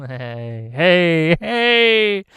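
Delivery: hey building from a laugh into a loud call; rising loud hey hey hey at chat; jokey building shout. Real, not acted.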